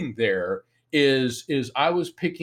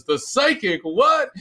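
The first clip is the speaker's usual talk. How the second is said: high, drawn-out disbelieving question; incredulous; 12 semitones higher, 4 dB louder, slower than usual. Retold or imitated. Big guy hearing of the psychic lawyer, incredulous. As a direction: imitate a wiseguy balking, slow and high.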